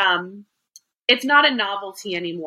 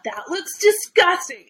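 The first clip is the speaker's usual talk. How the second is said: higher-pitched mock disgust on DISGUSTING; disgusted kids; 8 semitones higher than usual. Host mimics kids reacting to food videos, disgusted kids. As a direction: imitate a kid grossed out, pitched up. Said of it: "the kids are like".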